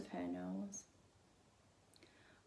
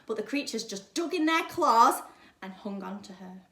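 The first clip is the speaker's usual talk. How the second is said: plain reading voice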